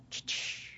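soft unvoiced ch-ch-ch of the doors opening; whispered door swish. Imitating the emergency room doors hissing open. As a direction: breathe out the sliding door sound. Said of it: "They go".

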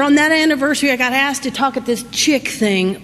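ordinary delivery

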